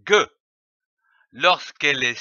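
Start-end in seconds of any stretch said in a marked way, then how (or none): 0.1-0.3 G said as hard "gue"; letter sound demo. Shown as a demonstration. Teacher explains G before A, O, U, G as hard "gue". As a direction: pronounce G as g plus a short schwa. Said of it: "prononcée".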